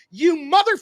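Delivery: hammed-up furious retort; mock angry outburst. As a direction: snap it like you're about to swear. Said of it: "when you're like".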